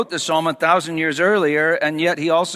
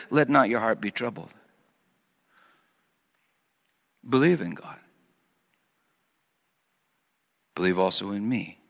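tender reassurance; 7 semitones lower, 7 dB quieter than usual. Acted. Quoting Jesus' comfort, softer.

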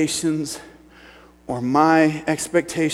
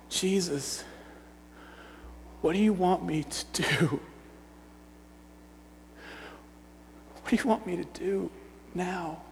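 higher, soft, halting plea; pleading prayer; 5 semitones higher, 8 dB quieter than usual. Acted. Man collapsed in his friend's arms, pleading softly to Jesus. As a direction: plead helplessly with long pauses.

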